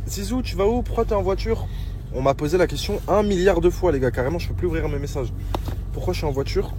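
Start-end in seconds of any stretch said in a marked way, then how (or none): 0.1-1.7 high, eager fan voice; imitating fans. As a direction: ask it like a curious fan. Voicing fans' questions.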